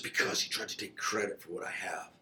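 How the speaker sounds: breathy whisper, barely voiced; hushed, wounded whisper of a grievance; whispered confession. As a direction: confess it under your breath. Answering God while lying down.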